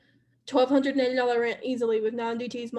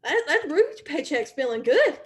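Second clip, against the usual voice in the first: pleased, higher and louder self-hype; feeling good; 4 semitones higher, 4 dB louder than usual. Pretend. Recruiter imagines enjoying a Ruby paycheck, playfully excited. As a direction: savor it with a swooping, excited lilt. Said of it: "Your feelings, you're start, you're gonna start being like".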